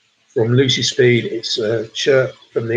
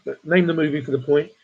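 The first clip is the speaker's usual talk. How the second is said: ordinary talk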